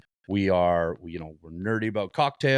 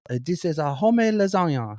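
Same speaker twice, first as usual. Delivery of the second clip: high, hammed-up Italian old woman; worsening Italian accent; 7 semitones higher, 4 dB louder than usual. Old Italian woman presenting her dish, terrible Italian accent. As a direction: pitch it up in a bad Italian accent. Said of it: "these accents are getting worse".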